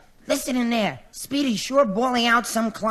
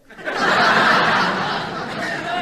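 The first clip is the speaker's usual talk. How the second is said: breathy high-pitched giggle; a giggle after his own bashful joke; 6 semitones higher, 3 dB louder than usual. Real, not acted. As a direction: let a silly high giggle out. [name] laughs at his joke.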